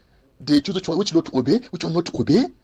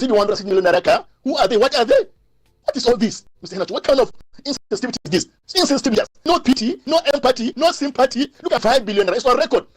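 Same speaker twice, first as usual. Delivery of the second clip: heated outraged rant; 9 semitones higher than usual. Real, not acted. Caller ranting at leaders' insensitivity.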